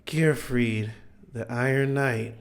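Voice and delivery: a faint dying call; weak voice. Retold or imitated. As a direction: barely get the words out, exhausted. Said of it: "'cause he was very weak".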